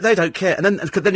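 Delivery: ordinary talk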